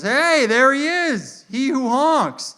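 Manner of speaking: slow, high, loud hail; drawn-out mock-heroic welcome; jovial cop greeting. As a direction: hail him grandly like a legend.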